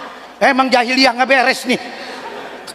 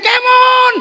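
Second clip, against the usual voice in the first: egging-on shout; 11 semitones higher, 4 dB louder than usual. Acted. Urging the throwers on.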